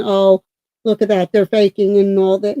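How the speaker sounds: ordinary delivery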